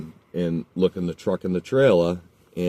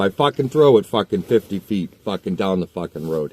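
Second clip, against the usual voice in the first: ordinary delivery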